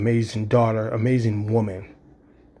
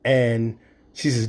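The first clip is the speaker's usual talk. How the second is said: ordinary talk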